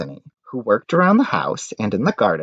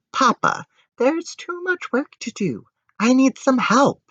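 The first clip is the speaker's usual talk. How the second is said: put-on much higher, pleading little girl; girl's voice pleading. Daughter pleading with her father, in a high girlish voice. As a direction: beg Papa for help in a small high voice.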